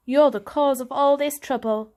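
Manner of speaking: cold anger; accusing. Acted. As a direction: jab the blame at him. Julius blaming Varjak.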